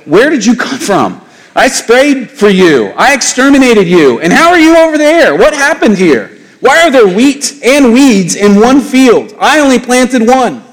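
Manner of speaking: high, incredulous rant at the weeds, rising as it goes; a homeowner losing it over weeds that came back after spraying; exasperated gardener. Retold or imitated. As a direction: play a fed-up farmer yelling at his weeds.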